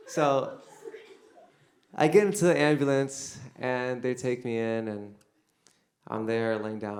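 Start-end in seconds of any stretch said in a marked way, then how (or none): none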